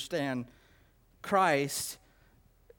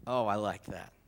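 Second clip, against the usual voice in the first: ordinary talk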